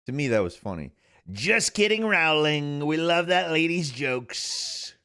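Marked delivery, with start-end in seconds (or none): 1.3-4.9 higher, drawn-out parody voice; Donald Trump. Acted. Streamer redoing last week's Rowling bit, Trump impression. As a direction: imitate the president riffing. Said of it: "having Trump talk about".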